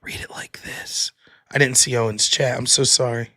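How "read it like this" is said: soft ASMR-style whisper. Shown as a demonstration. Streamer checking what ASMR means, in a whisper. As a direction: breathe it out like an ASMR video. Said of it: "Does that mean, like".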